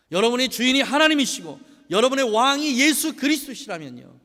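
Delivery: loud, high, impassioned sermon delivery; rising pulpit fervour; full-force preaching. Acted. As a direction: preach it out to the congregation. Preaching to congregation.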